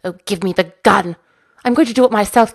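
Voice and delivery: loud furious yell at Finn; the Queen loses her temper and screams; screamed. A narrator's reading. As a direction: shout it, fed up with him. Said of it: "I screamed".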